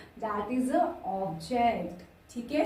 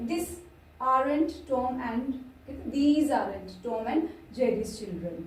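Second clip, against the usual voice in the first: slow dictation; much slower than usual. Shown as a demonstration. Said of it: "slow dictation of example".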